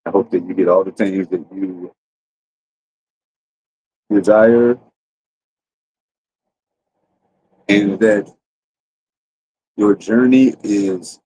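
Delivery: slow, drawn-out heartfelt eleven-eleven wish; a half-playful solemn wish to the audience, spoken slowly; sincere wish. Pretend. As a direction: make a slow, earnest wish for every listener. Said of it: "it was eleven eleven. Let's go, baby".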